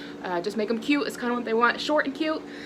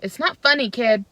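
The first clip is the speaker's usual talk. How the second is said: ordinary talk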